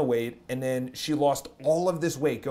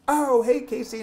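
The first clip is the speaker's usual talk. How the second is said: high, awkward pretend-to-know hello; fake recognition; 10 semitones higher, 5 dB louder than usual. Vlogger faking he knows the girl, high and awkward. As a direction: cover that you have no idea who she is. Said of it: "And I'm like".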